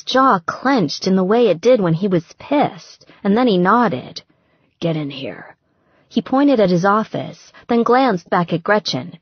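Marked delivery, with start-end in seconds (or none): 4.8-5.6 harsh whisper through the teeth. Acted. Angry boss whispering to the visitor, harsh whisper. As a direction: hiss it low so no one hears.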